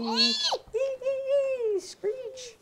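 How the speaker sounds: high, thin pretend bat screeches; playful high-pitched bat screeching; bat noises. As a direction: squeal like little bats. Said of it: "Those are good bat noises".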